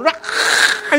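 high, loud strangled mock scream; frantic inner scream. Little person in your head losing it, mock scream. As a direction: screech like an overwhelmed inner self. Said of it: "little person in your head is going".

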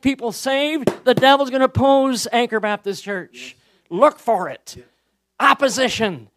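forceful, emphatic sermon voice; full-force preaching. Acted. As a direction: thunder a warning to the congregation.

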